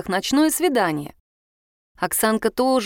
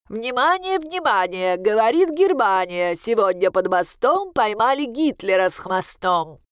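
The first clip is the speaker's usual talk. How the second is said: pinched-nose nasal train station announcer. Acted. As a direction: hold your nose and call out like a railway announcer. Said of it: "зажав нос гнусавым голосом тёти, объявляющей поезда".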